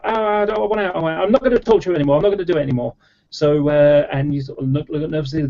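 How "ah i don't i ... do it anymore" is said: higher, hammed-up plea to be let out; mock desperate pleading. Learner in the chair begging the teacher to stop, mock panicked and higher. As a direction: play the strapped-in learner begging, voice pitched up. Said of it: "I sort of go".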